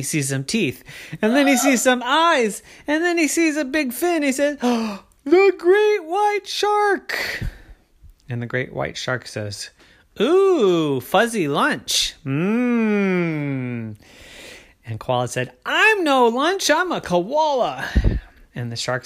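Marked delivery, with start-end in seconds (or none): 1.2-7.4 alarmed amazement. Acted. Koala suddenly sees the shark. 10.2-14.0 raised, savoring shark with long relish; hungry shark. Acted. Shark relishes seeing its lunch. 15.6-17.9 high, loud, slow insistence; indignant protest. Acted. Koala tells shark he is no lunch, indignant protest.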